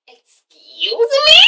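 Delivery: high, slow, drawn-out outrage; indignant excuse me. Retold or imitated. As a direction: stretch it out in mock disbelief. Voicing Mimi's outrage.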